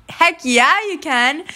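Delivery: high, punchy imitated cheer; enthusiastic welcome. Girls telling a newcomer she can come, enthusiastically. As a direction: welcome them in with a loud, high yes.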